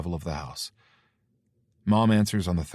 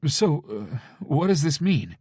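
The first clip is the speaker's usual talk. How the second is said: bewildered mother; 8 semitones higher, 5 dB louder, slower than usual. Acted. Mother voiced.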